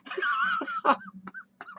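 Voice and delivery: very high, crying clown cackle; shrieking clown laugh. Acted.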